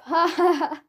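loud, high acted belly laugh. Tom cracking up at Buster's nickname, loud laugh. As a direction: burst out laughing at full volume. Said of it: "I do such a loud laugh".

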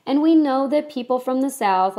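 ordinary talk, nothing marked

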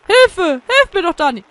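loud, high yell for rescue; crying for help. Acted. Man begging Dani for help, shouting. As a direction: shout out in mock distress. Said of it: "schrie der Mann".

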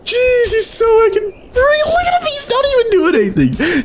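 very high, squealing disbelief; amused shriek. Real, not acted.

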